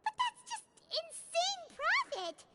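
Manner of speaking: excited. Acted. Paimon excited about profit.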